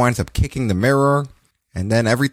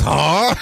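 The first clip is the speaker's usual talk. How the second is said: mock shouted grunt; 6 semitones higher than usual. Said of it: "he goes".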